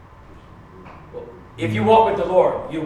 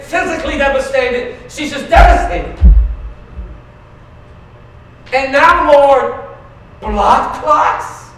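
higher, louder outraged plea to God; anguished protest; 5 semitones higher, 4 dB louder than usual. Retold or imitated. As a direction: cry out at God, unable to accept her pain. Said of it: "As tears welled up".

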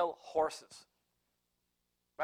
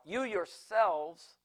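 "you yourselves" said very slowly, each word spaced out; preacher dwelling on the plural for listeners, slow stress; emphasis on yourselves; 3 semitones higher, much slower than usual. Shown as a demonstration. Stretch "you yourselves" out word by word. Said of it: "what I like about the yourselves part".

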